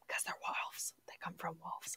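unvoiced whispered aside; whispering. Real, not acted. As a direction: whisper it as a secret. Joking aside.

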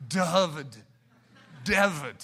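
"David" blended from duh and ahava, then said plainly; sound demo payoff. Shown as a demonstration. Pastor revealing the word after the sound-out, a little laughing. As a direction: land the word "David" as the finished sum. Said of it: "See? I just taught you some Hebrew".

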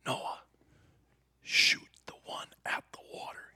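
hushed whisper so the antelope won't hear. Retold or imitated. Son whispering to his dad in the blind, whispered. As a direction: breathe the words out with no voice.

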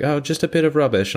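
high mock-British customer; imitated British accent. British customer dropping off rubbish, British accent. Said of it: "some British people that come in".

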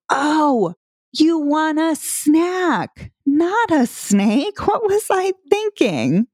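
high, slow, exaggerated oh-silly-me; playful mock realization. Shown as a demonstration. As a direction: ham up catching your own mistake. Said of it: "I'll go".

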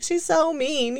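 high whiny mock complaint; childish complaint. Daughter sulking about her tough mom, high and whiny. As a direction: whine it high like a hurt kid. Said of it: "at the time I was like".

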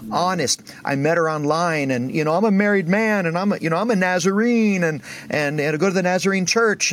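high-pitched mock tearful confession; imitating bawling. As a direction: act out a man blurting it out, breaking down. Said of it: "he just breaks and starts bawling".

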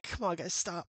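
soft, timid dad asking them to stop; meek plea. Retold or imitated. Dad quietly begging wife and son to stop, soft and meek. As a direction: ask them to stop softly, without much hope. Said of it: "as the dad's like".

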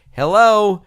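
loud shouted hello at the door; hollering. Retold or imitated. Joe Porter calling out to walk-ins at rehearsal, hollering. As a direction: call out to stop the intruders. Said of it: "he starts hollering out".

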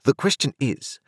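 a crisp, authoritative London lawyer; stern lawyer voice. A narrator's reading. Jaggers presses Joe.

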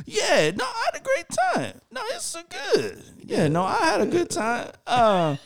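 very high, over-polite goodbye voice; fake cheerfulness. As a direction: gush falsely to cover the humiliation.

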